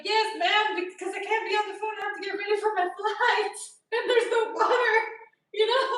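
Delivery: loud, high, fed-up rant; retold exasperated reply.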